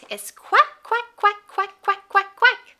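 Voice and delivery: high playful quacking; duck quack. Pretend. Duck sound for toddlers.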